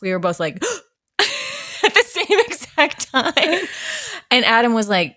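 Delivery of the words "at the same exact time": high sharp gasp then a laugh; audible gasp. Retold or imitated. Fans spotting Larry David, gasping in shock. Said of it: "the audible gasp".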